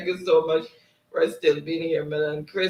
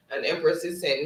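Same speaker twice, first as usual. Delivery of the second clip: usual speaking voice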